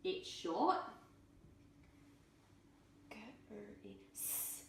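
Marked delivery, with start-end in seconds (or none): 3.1-4.6 whispered "G-r-i-s" sound by sound. Shown as a demonstration. Teacher segmenting gris, soft whispered sounds. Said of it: "It's next to each other".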